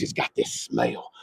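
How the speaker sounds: hushed whisper; breathy hushed aside. Real, not acted. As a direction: whisper it like a secret. Whispering about the truck's smell.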